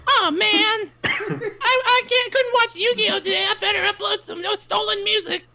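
extremely high falsetto cartoon mouse; hammed-up mouse voice, far above normal pitch; Mickey Mouse. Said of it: "That's my favorite Mickey Mouse impression".

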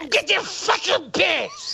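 high, slow mocking echo of her whine; mocking imitation. Host mocking the streamer's complaint, high slow mimic. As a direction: parrot her words back slowly and high. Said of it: "Listen to the way she says this".

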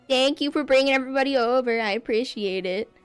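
usual speaking voice

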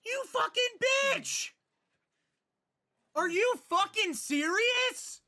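real rage, high shrieking yells; furious screaming. Real, not acted. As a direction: scream at the screen in disbelief. Furious reaction to TV death.

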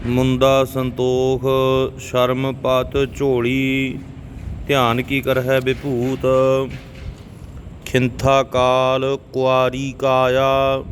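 careful teaching recitation in Punjabi; scripture recitation. Shown as a demonstration. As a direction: recite evenly, drawing out the long vowels.

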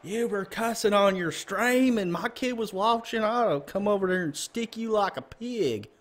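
high-pitched, slow Southern drawl; irate parent with a drawn-out Southern twang; Southern accent. Acted. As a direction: put on a high Southern voice, stretching the words. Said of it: "Southern accent either".

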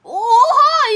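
high, loud disbelief; alarmed shout. Real, not acted. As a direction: call out in alarm at a fire. Sees fire in game.

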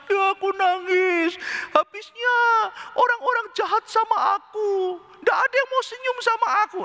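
high-pitched wailing, hammed-up; mock crying. Shown as a demonstration. Preacher mimics self-pitying person complaining people are mean, mock crying. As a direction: whine and bawl in self-pity, far above normal pitch. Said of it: "lihat ya, ada orang nangis begitu".

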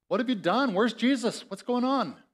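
high, anxious rush of questions; panicked voices at the empty tomb; alarmed women. Acted.

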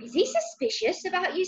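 put-on high-pitched worried boy; panicked. A narrator's reading. Ben asks Granny about the spying neighbour, a little panicked. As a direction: ask it high, uneasy about being found out. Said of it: "more than a little panicked".

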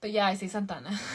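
normal talking voice

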